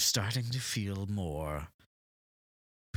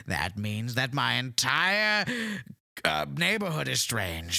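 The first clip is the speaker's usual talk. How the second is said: offended; 7 semitones higher, 4 dB louder than usual. Pretend. Mary offended about family.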